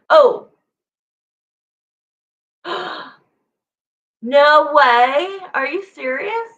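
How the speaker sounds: high, loud gasping disbelief; real shocked delight. Real, not acted. Streamer stunned by viewers' gift, high loud disbelief. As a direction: caught off guard by a generous gift.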